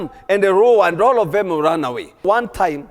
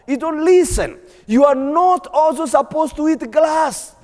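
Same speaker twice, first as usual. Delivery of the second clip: pitched up, pressing reproach; scolding lion; 7 semitones higher than usual. Acted. Wild lion scolds the tame one, stern and raised.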